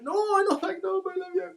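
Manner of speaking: high, whiny, teary insistence; clinging to the phone, near tears; drunken tearful pleading. Retold or imitated. Drunk crying self insisting.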